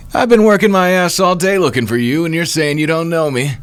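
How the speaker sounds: very high, put-on villain voice; theatrical high-pitched complaint; indignant villain. Voices the taunting enemy Oster.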